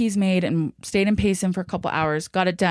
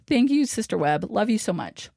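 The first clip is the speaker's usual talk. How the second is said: ordinary delivery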